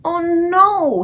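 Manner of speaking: high, loud cry of alarm; the BFG recoiling from a nightmare dream; dismayed cry. Acted. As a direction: gasp it out in horror. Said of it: "he cried".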